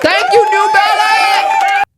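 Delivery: big high, slow, loud shout of thanks. Real, not acted. Shouting thanks to New Balance for the discount, loud and high. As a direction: call it out across the room.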